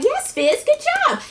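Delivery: high mock-cheerful yes Fizz; sarcastic praise. Recapper mocks a cast member's bragging, high and sarcastic.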